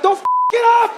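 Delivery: high, loud scolding yell; mock parental shouting; harsh parent. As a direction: yell it as a blunt warning. Parents' harsh reply acted.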